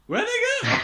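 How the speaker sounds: put-on squeaky baffled child; hammed-up bewildered kid; confused kid voice. Kid confused by empty screen.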